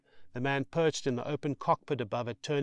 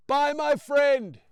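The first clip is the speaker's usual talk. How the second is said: high, loud, slow farewell; shouting; 7 dB louder, slower than usual. Acted. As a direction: draw out a shouted goodbye. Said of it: "shouts Brian".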